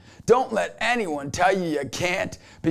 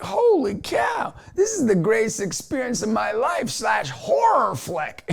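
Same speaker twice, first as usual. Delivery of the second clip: retold amazement; 7 semitones higher than usual. New father reacting to long labor, mock-overwhelmed. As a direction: exclaim it high, half thrilled, half horrified.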